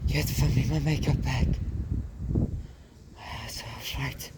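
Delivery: French mother mouse. Acted. Mother demanding her makeup bag, high and imperious. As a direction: order it like a vain diva.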